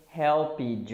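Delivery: "helped" in two syllables, HEL-pidge; Brazilian mispronunciation. Shown as a demonstration. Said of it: "I hear many Brazilians saying".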